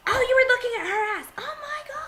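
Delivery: high mock accusation fading to a whisper; jealous girlfriend. A girl fighting her boyfriend at the mall, a high mock jealous accusation. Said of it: "outrageous display".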